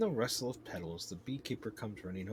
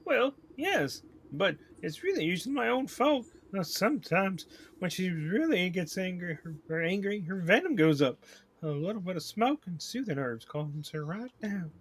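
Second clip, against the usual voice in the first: higher-pitched, put-on southern voice; weird southern accent; 9 semitones higher, 9 dB louder than usual. Beekeeper explains his angry queen, weird southern accent. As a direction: explain away her temper in an odd southern accent. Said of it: "like, a weird southern accent".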